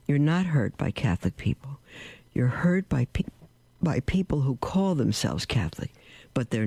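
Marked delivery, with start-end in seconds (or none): none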